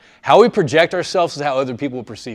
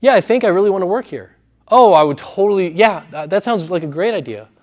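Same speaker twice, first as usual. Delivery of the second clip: "work here" and "great idea" left hanging on a raised pitch, unsure; sentences ending pitched up; 5 semitones higher, 4 dB louder than usual. Shown as a demonstration. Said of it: "on a pitch up where they go".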